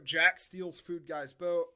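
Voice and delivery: usual speaking voice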